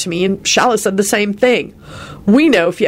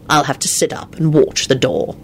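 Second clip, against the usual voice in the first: ordinary delivery, nothing marked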